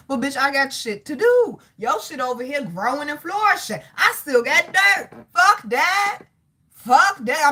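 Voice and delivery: fed-up, hammed-up outburst; indignant retort. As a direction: build to a loud, high-pitched protest. Acted retort to resentful people.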